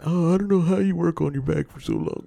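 mocking a fussy millennial Karen. Teasing his friend as a fussy Karen, mock whine. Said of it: "you like one of the millennial Karens".

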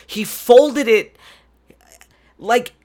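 angry customer losing it over the folded vinyl; furious outburst. Real, not acted. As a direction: yell it in disbelief. Furious rant continues.

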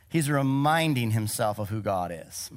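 ordinary talk, nothing marked

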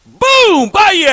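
high, loud boom shout; impact shout. Retold or imitated. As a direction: shout out the big hit. Hit on punt coverage.

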